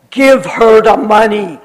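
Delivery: firm demand. Retold or imitated. Missionary lady demanding the money for her, loud. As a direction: order it settled.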